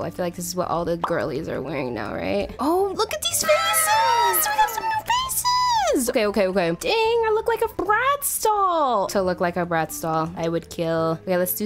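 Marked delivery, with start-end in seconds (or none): 2.6-6.1 very high, drawn-out squeal; sustained squeal of delight; thrilled squeal. Real, not acted. Thrilled by new faces. 6.9-9.1 high, stretched-out gushing; high squeal of admiration; delighted squeal. Real, not acted. Delighted by own look.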